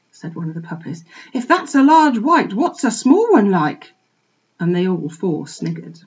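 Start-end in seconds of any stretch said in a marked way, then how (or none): 1.3-3.9 mocking puppy. A narrator's reading. Puppy mocks the piglet.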